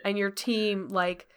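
ordinary talk

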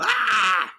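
a high, quick "Ah!" from behind the door; startled cry. Acted. Woman inside reacts to the door crashing in. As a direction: yelp in fright. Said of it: "you hear a".

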